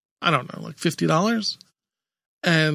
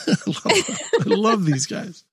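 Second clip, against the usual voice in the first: laughing; 5 semitones higher than usual. Real, not acted. Laughing while repeating.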